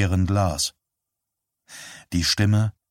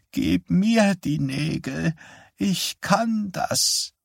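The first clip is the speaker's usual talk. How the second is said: put-on confused old mother; 10 semitones higher, much slower than usual. Confused mother asks for the nails, high and faint. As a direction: murmur nonsense feebly.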